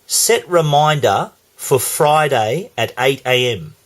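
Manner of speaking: slow, measured command to a phone; spoken carefully for a voice assistant; deliberate voice command. Real, not acted. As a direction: dictate it slowly with clear gaps between phrases. Dictating a command to Siri.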